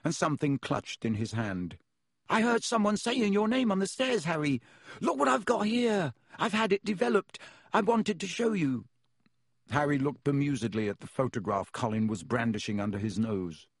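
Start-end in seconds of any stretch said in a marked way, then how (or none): 2.3-8.8 put-on much higher, keen young fan voice; bursting to show his photo; eager young boy. A narrator's reading.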